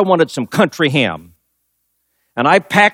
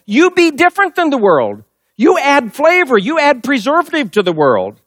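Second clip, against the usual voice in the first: raised, higher preaching voice; 6 semitones higher than usual. Acted. Pastor warning his flock in Jesus' words, raised preaching. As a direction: press the warning home to the congregation.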